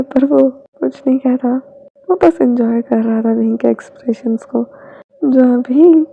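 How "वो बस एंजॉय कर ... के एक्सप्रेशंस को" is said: usual speaking voice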